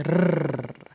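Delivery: rolled rrr like a scooter engine, low. Shown as a demonstration. Teacher imitates a scooter engine, low rolled rrr. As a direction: purr a trilled r like a motor. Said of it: "जैसे scooter चलाते हैं ना, वैसे आवाज़ आती है".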